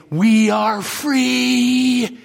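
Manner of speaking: very slow, drawn-out declaration; slow proclamation. Acted. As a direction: stretch every word of "We are free". Proclaiming freedom slowly.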